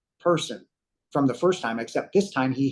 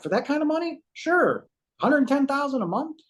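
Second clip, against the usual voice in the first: very high, retold amazement; amazed agreement; 12 semitones higher than usual. Man weighing a stranger's money offer, amazed. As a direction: pitch it way up, marvelling at the offer.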